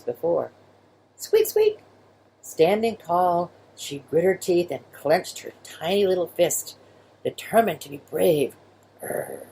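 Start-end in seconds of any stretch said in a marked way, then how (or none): 1.1-1.8 tiny squeaky mouse noise; high mouse squeak. A narrator's reading. Narrator voicing a mouse, high squeak. 9.0-9.5 tiny growl. Acted. Girl growls to be brave.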